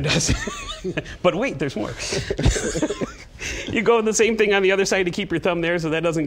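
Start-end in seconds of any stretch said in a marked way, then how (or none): 0.4-1.2 quick high burst of laughter; bright chuckle; short laugh. Real, not acted. Host's joke about [name] and [name]. 2.0-3.6 airy unvoiced laughter; breathy chuckle. Real, not acted. Laughing at his own line.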